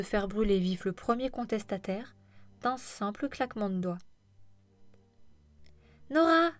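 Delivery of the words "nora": high, loud cry of a name; exclaiming. A narrator's reading. An elated boss greeting Nora, exclaiming loudly. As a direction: call out her name with delight. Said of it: "s'exclama Ellie".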